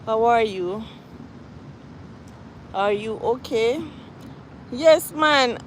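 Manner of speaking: ordinary talk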